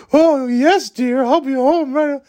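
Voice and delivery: high, simpering whipped-husband voice; meek henpecked husband. Retold or imitated. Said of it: "he starts imitating me".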